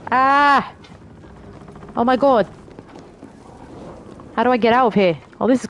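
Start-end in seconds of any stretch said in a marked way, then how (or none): none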